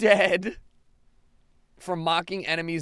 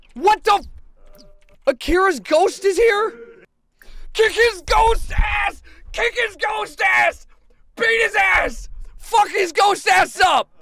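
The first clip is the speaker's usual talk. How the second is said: high, loud furious yelling; wild battle shouts; shouting; 13 semitones higher, 7 dB louder than usual. Real, not acted. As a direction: scream at the ghost to get him. Fighting a ghost.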